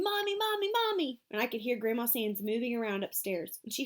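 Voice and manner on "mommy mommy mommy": high, panicked calls for Mommy; screaming. A narrator's reading.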